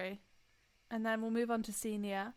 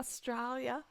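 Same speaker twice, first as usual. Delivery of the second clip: lightly put-on Aussie accent; retry a dodgy Australian accent; attempted Australian accent; 3 semitones higher than usual. Shown as a demonstration. Host tries Australian accent once more on co-host's request. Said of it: "try your Australian accent one more time".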